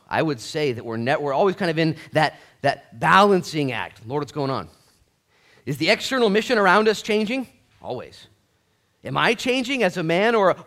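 loud, high, driving sermon voice; full-force preaching. Acted. Pastor rousing the congregation, loud fervent preaching. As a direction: preach it hard, building to the rafters.

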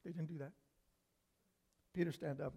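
ordinary delivery

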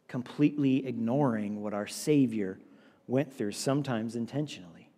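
low, soft, slowed-down sermon voice; hushed preaching. Real, not acted. Preacher confronts listeners about flirting with sin, hushed. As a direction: bring the sermon right down low and quiet. Preacher drops to grave hush.

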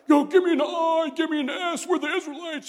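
loud, high cheerleader chant; mock battle cry. Israelite army shouting its battle cry, loud mock cheer. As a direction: pump up the troops like a pep rally. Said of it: "I don't know what that sounded like".

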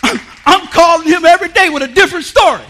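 loud, high excited telling; excited preaching. Acted. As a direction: burst with the news. Excited retelling.

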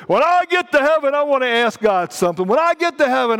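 high, loud mock insistence; mimicking eager people. Preacher imitating people with questions for God, mock eager. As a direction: parody people announcing their big plans.

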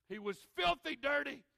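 filthy stressed, preached loud and falling; emphatic loud. Acted. Preacher to congregation, describing the son, loud and emphatic. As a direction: lean hard on filthy, full voice.

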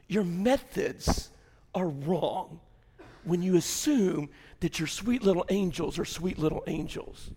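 ordinary talk

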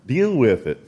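ordinary talk, nothing marked

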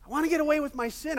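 David talking himself into it, voice lifting; David's rising urge. Acted. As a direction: push the words out, eager and quick.

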